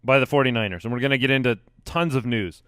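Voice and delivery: ordinary talk, nothing marked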